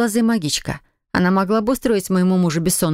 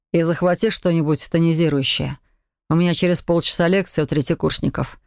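usual speaking voice

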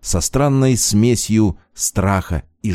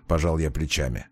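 ordinary delivery

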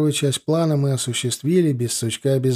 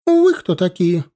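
high, raised indignant challenge; acted shrieky protest; loud wailing outcry; 6 semitones higher, 5 dB louder than usual. A narrator's reading. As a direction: cry it out, higher and louder. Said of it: "начала голосить молодая".

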